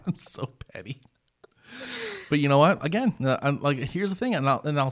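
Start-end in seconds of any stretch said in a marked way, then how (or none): 0.1-1.0 whispered confession; hushed, half-laughing admission. Real, not acted. Admits pettiness with a laugh.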